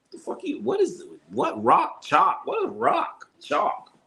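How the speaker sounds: high, incredulous retold reaction; mock bewildered, pitch jumping up; baffled outburst. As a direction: squeak out total confusion. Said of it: "I'm like".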